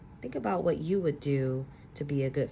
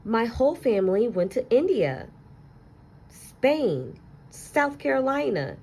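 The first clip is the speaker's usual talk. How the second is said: put-on excited kid; 9 semitones higher, 10 dB louder than usual. Classmate boasting of travels.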